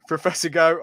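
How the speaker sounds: ordinary talk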